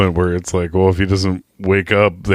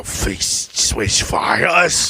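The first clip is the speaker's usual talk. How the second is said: a hammed-up gangster correcting himself; mock slow drawl; much slower than usual. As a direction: stretch every syllable, mocking. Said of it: "We gotta switch fighters".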